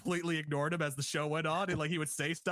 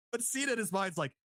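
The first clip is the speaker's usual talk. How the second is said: ordinary delivery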